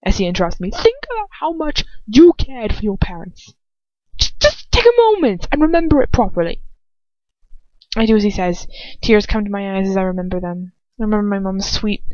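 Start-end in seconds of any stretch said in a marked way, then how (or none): 0.7-6.6 higher, insistent command; raised voice. A narrator's reading. Lucius insisting she remember, voice raised. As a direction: push her to remember. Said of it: "his voice raising".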